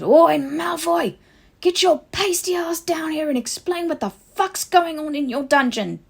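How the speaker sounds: high, loud furious bellow. Acted. Harry bellowing for Malfoy down the hallway, furious. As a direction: yell it up the whole house. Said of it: "bellowing at the top of his lungs".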